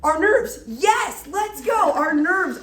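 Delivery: high, loud celebration; pumped-up teacher praise; excited cheer. Real, not acted. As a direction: cheer on a right answer. Cheering a correct answer.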